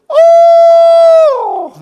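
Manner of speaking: very high loud owl shout; high loud hooting shout; owl call. Shown as a demonstration. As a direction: call out loud to make a turkey gobble. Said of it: "just go".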